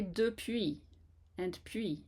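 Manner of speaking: depuis and puis slow, with a narrow U, no W; correct French pronunciation. Shown as a demonstration. Teacher models correct puis and depuis slowly. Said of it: "So we say".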